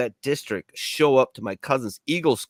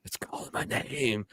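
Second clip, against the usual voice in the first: whisper; 10 dB quieter than usual. Acted. Cigarette calling to him.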